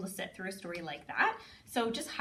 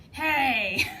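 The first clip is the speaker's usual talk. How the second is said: high, loud cry of Hey; playful call; 6 semitones higher, 9 dB louder than usual. Shown as a demonstration. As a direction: shout it out for fun. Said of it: "we always go".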